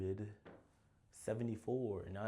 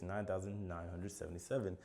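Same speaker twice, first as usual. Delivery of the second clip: normal talking voice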